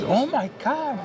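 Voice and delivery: surprised lady. Retold or imitated. Cashier lady overwhelmed by gift.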